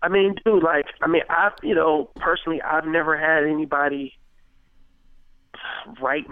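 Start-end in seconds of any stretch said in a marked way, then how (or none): none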